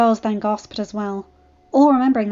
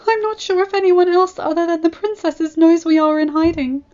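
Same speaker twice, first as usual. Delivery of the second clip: tearful; 8 semitones higher, 8 dB louder than usual. Acted.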